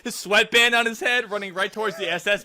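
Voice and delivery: high, lively, laughing storytelling; amused excitement. Real, not acted. Picturing a tennis player charging the S S, giddy excitement. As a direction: get carried away by the absurd picture.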